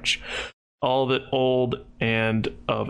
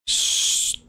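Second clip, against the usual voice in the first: ordinary delivery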